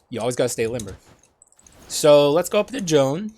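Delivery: normal talking voice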